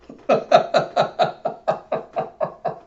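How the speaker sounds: high, breathless run of laughter; real laughter. Real, not acted. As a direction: crack up at the gag. Laughs at the peanut gag.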